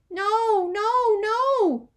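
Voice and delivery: put-on high, loud child's protest; little boy loudly refusing a spanking; shouting no. A narrator's reading. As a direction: yell it out in panic. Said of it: "Fudge shouted".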